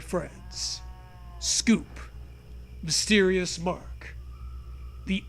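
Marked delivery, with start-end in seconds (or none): none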